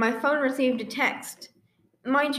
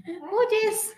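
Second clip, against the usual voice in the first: mock childish who dis; 9 semitones higher than usual. Replaying childish reply.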